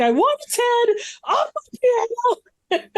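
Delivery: very high, hammed-up ranting demand; over-the-top movie villain. Imitating a B-movie boss demanding a head, over the top. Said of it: "the most over the top scene".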